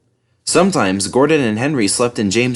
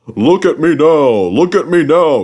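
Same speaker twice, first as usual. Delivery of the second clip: boastful puffing; 6 semitones higher, 7 dB louder than usual. A narrator's reading. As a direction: show off as you leave. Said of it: "he puffed".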